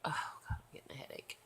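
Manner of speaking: weary unvoiced whisper. Real, not acted. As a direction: breathe it out, drained. Tired late-night vlog, whispering.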